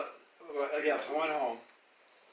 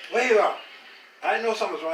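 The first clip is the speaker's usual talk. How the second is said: loud, high call across the house; 7 semitones higher, 8 dB louder than usual. Retold or imitated. Mother searching for her hiding son, calling loudly. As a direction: call out for the child.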